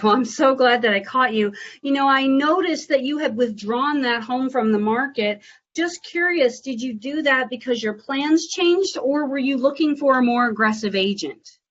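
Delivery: upbeat sales caller voice; high, bright phone voice. Real, not acted. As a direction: greet the owner warmly and eagerly. Cold call phone voice.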